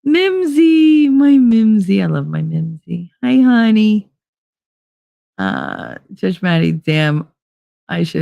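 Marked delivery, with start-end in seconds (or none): 0.0-4.1 loud, high delighted hello; excited greeting. Real, not acted. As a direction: call out to a beloved viewer with joy. Loudly greeting a favorite viewer.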